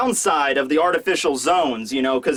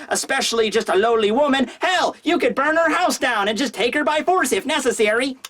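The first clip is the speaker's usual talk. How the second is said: higher, wheedling mouse voice; Mouse Man voice; 8 semitones higher than usual. Retold or imitated. Mouse Man egging on Urashima, higher and sly. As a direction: flatter him like a sly little mouse. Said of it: "And Mouse Man's like".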